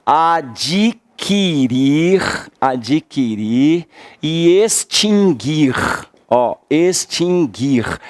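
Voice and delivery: slow dictation. Shown as a demonstration. Teacher dictating for students to write down, very slowly. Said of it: "se você quiser anotar aí".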